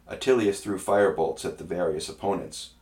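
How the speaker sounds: usual speaking voice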